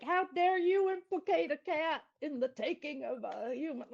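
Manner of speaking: high, loud mock outrage; outraged commenter. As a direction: play the offended commenter.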